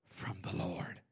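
whispered close to the mic. Acted. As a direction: breathe the words out. Preacher whispers phrase.

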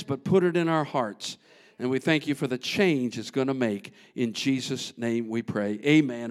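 hushed closing prayer. Real, not acted.

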